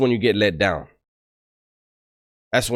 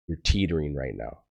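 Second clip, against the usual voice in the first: low, soft voice of authority; lightly voiced captain, quietly warning; imitated captain's warning; 7 semitones lower, 9 dB quieter than usual. Retells captain's warning to him.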